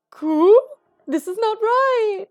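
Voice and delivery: a small bird aghast at the empty bean bins; indignant pigeon. Acted. Pigeon gasps at sold-out beans, high and indignant.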